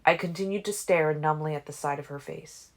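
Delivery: ordinary delivery, nothing marked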